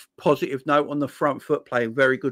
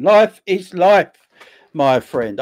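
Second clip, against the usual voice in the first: "Life is life" drawn out slow, higher and louder; slow emphatic; 4 semitones higher, 7 dB louder, much slower than usual. Real, not acted. Streamer insisting to his chat, slow and loud. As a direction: lean hard on each word of "Life is life". Repeats phrase, second time emphatic.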